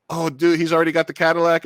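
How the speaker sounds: voicing an excited viewer; excited viewer reaction. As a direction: blurt it out quickly like a thrilled fan.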